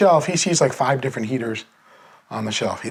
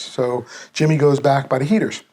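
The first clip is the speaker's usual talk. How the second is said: ordinary talk, nothing marked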